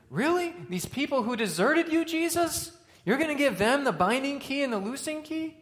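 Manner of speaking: high, loud, disbelieving challenge; really, you'd trust THEM?; incredulous. Pretend. Preacher questioning Jesus' choice, high incredulous disbelief.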